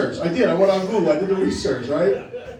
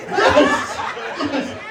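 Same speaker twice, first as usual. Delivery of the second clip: triumphant yes. Real, not acted. Crowd member finally reacts.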